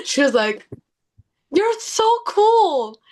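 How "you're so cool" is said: put-on high, drawn-out gushing American accent. Singer imitating a fan who called her over, American accent. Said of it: "Can I do an American accent?".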